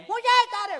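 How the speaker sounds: put-on very high, loud child's shout; mock child shouting; cheeky kid shout. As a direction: holler back like a cheeky boy. Boy shouts back.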